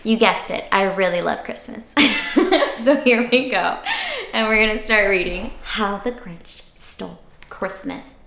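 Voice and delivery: ordinary delivery, nothing marked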